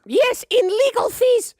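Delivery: very high, loud granny demanding payment; high-pitched forceful grandma voice; bossy old grandma. Acted. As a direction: demand your due as an imperious old woman. Game master plays grandma.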